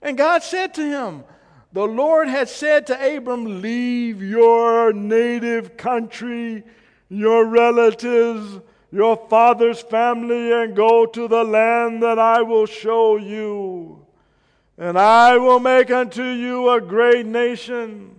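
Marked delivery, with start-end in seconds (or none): 1.7-14.0 much louder, slow and drawn out; booming voice of God. Pretend. Preacher acts God calling Abram, booming and slow. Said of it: "I don't know if it sounded like that". 14.8-18.2 slow, booming, much louder voice of God. Pretend. Preacher booms God's words to Abram in a slow, loud voice. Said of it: "I don't know if it sounded like that".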